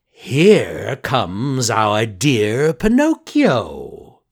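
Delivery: very slow, loud, sly fox greeting. Acted. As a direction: drawl it out like a fox. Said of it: "cried the fox".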